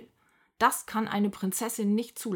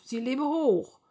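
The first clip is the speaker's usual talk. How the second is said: loud, raised crowd cry; jubilant cheer; 5 semitones higher, 5 dB louder than usual. A narrator's reading. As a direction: hail the heroine at full voice. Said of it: "Die Leute jedenfalls jubeln Pippa zu".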